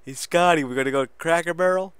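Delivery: high, loud, eager fanboy voice; excited P D Williams. Retold or imitated. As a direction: squeal it like a giddy fan. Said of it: "He's like".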